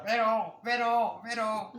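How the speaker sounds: high mock penguin cry, pero; penguin call. Grandfather imitating the male penguin calling, high squawk. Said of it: "empieza a decir".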